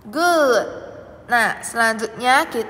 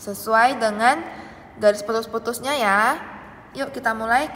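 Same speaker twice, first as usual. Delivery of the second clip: ordinary talk, nothing marked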